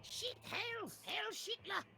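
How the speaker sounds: high, hammed-up salute; mock dictator yell. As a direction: shout it as a parody tyrant. Mocking Nazi salute chant.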